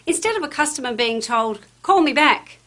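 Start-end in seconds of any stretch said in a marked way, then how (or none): 1.8-2.6 curt order. Shown as a demonstration. Curt reply told to customer.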